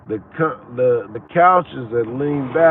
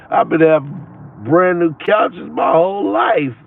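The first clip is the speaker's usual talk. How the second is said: raised, louder burst of amazement; excited outburst; 5 semitones higher, 4 dB louder than usual. Real, not acted. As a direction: blurt it out, louder and higher. Laughing, excited about his couches.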